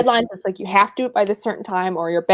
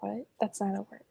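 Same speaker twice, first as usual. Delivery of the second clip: mock whisper; 17 dB quieter than usual. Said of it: "It's like".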